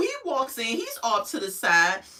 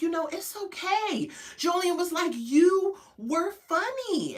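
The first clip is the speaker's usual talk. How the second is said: put-on comforting boyfriend; 7 semitones higher, 3 dB quieter than usual. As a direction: softly coax her like a kind boyfriend. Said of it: "Julian is like".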